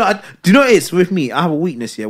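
ordinary talk

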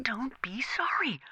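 slow whispered rebuke; hissed rebuke. Acted. Manager to operator, a slow whispered rebuke.

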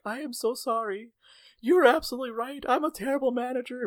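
high, mock-tearful apology; imitated crying. Manager apologizes in the office, imitated tearful whine. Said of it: "like, crying and she was like".

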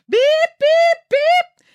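high, loud heart-monitor beeping; shrill out the beeps like a monitor alarm; deafening alarm beeps. Acted. Heart monitor alarm across the room, piercing beeps. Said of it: "started emitting a deafening alarm".

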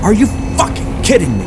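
high, shouted disbelief; furious. A narrator's reading. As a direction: yell it at the sky. Sinking in mud, furious.

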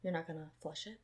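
very soft, puzzled client; hesitant question. Retold or imitated. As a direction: ask it timidly, barely voiced.

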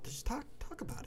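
very high, soft squeak; squeaky voice. Real, not acted. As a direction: squeak it out quickly. High-pitched squeak, fast.

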